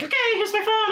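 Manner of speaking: high-pitched, eager hand-over; re-enacted fannish excitement; excited fan greeting. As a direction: chirp it as a keen fan.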